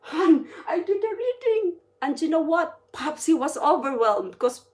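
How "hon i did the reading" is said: higher-pitched, eager news to a loved one; excited retelling. Retold or imitated. Telling Popsy about doing the reading, excited. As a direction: share the news with a lift in pitch.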